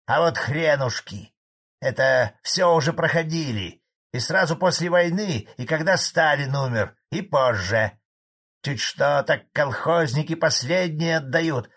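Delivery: high, loud, heated tirade; angry old farmer. A narrator's reading. Farmer tells chairman he has seen it all, angrily. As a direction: rail at those who make the plans. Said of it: "От злости Семиручка даже стал мне тыкать".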